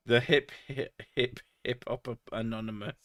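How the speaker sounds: stuck stammering on "hip, hip" before a garbled "hip hop anonymous"; struggling to pronounce hippopotamus. Retold or imitated. Movie character failing to say hippopotamus, stammering "hip, hip hop anonymous". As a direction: stumble over "hip", repeating it, then mangle the rest as "hip hop anonymous". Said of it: "trying to say hippopotamus".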